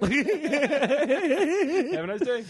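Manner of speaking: mock cashier. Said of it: "is just like".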